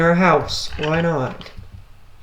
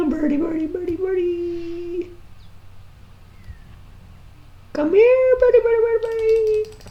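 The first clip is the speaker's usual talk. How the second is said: very high squeaky bird call; coaxing a wild bird; 13 semitones higher than usual. Real, not acted. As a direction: coo up high to lure it.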